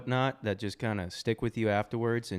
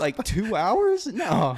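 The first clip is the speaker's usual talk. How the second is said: high, slow disbelief; incredulous; 8 semitones higher, 5 dB louder, much slower than usual. Retold or imitated. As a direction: stretch out the shock. Incredulous retold reply.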